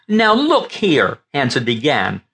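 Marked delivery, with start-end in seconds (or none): 0.1-1.2 higher, slow, exasperated protest; nearly beside himself, drawing it out; frustrated outburst. Acted. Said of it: "practically beside himself with frustration".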